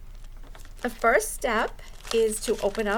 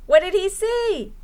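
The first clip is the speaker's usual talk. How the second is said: usual speaking voice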